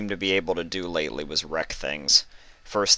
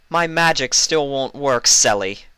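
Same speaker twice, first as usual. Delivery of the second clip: higher, louder, pouting little princess; high pouty Luna complaint; 7 semitones higher, 5 dB louder than usual. Acted. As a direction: whine it like a sulky younger sister. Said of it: "she pouted".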